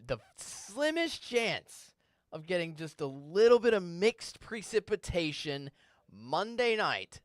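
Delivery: slow deliberate forecaster caution; careful emphasis. Real, not acted. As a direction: spell out "the slimmest chance" slowly so nobody overreads it. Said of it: "pay attention to my statement here very closely".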